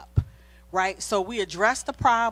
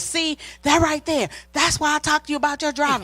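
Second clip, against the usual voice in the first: higher, louder, fast accusing jab; mock snappy retort; 7 semitones higher, 5 dB louder than usual. Wife snapping at husband over his driving, mock heated. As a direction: snap back like starting the fight. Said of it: "What I could have said was".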